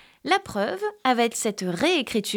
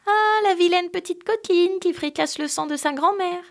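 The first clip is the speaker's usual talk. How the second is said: higher chanting angel voices; 9 semitones higher than usual. Acted. Angels in the chimney warning the girl, high sing-song. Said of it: "les voix ont recommencé à chanter".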